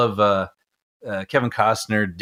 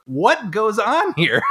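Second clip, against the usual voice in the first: mock baffled question; 11 semitones higher, much slower than usual. Joking with brother.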